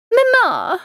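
put-on high, loud girlish call for mother; Lydia crying out. A narrator's reading. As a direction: call out eagerly in a high young daughter's voice. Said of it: "cried Lydia".

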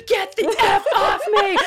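high, loud laughing yell imitating the husband; mock screaming. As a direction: scream it like someone shaken awake. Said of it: "Like, screaming".

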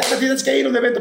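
voz más aguda de asistente apurado; aviso apurado. Retold or imitated. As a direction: avisa con prisa que se tiene que ir. Lo sacan para un evento. (asistente apurado que lo saca al evento)

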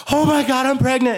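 high, loud mock wail of panic; mock crying. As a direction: imitate a girlfriend bursting into tearful alarm. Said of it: "and starts crying".

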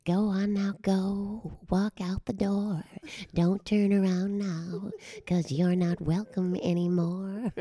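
lightly voiced sultry chanteuse. Shown as a demonstration. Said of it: "I did [name]".

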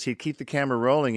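ordinary talk, nothing marked

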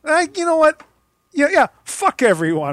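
exasperated outburst. Real, not acted. Mock outburst.

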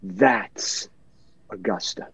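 a soft, hushed retelling of the member's words; quiet, reverent retelling; said quietly. Retold or imitated. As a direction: let the words drop low and soft, almost to yourself. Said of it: "and he said quietly".

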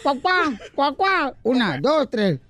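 like chickens. Pretend. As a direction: sing it as clucking birds. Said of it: "pero como gallinas".

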